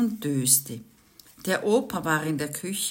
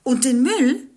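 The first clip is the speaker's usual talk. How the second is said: higher, louder emphatic neighbor; neighbor Jens; 8 semitones higher, 9 dB louder than usual. A narrator's reading. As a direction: raise the point with a loud, pushy start. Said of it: "sagte der Jens".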